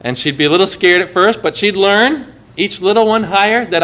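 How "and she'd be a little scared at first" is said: ordinary talk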